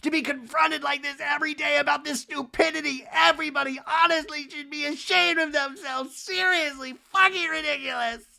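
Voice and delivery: high-pitched hammed-up tirade; mock outburst. Kyle ranting at Dorit outside, mock outraged. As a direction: burst out like an indignant housewife. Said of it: "And Kyle bursts out".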